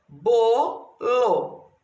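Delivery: "Bolo" split into two stretched syllables, bo... lo. Shown as a demonstration. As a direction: break "Bolo" in two with a clear gap. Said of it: "Isso aqui é a divisão silábica". ("Bolo" dividido em sílabas, com pausa: bo... lo)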